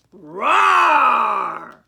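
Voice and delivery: loud, drawn-out roaring word; big roar. A narrator's reading. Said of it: "let out a great big roar".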